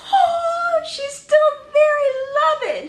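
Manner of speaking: very high, squealed coo; high fangirl swoon; gushing delight. Real, not acted. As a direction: squeal it with overflowing affection. Gushes over Batgirl.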